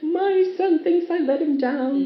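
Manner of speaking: laughing upset. Retold or imitated. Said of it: "And I cried".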